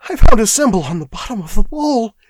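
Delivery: high, loud, panicky voice; frightened chatter. A narrator's reading. Shaking clerk reporting his discovery, chattering. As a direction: blurt it out in fright. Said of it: "chattered Primero".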